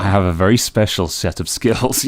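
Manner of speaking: loud film-hero threat impression; Liam Neeson. Action hero warning a caller, menacing impression. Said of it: "just do a Liam Neeson".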